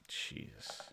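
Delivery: a stunned whisper under the breath. Pretend. Fake insider awed by his own leak, whispering. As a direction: breathe it out in awe.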